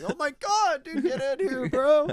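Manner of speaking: high-pitched, over-the-top party bro; excited bro. Acted. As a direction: whoop it up, welcoming a new buddy. Excited partygoer welcomes fake Frenchman.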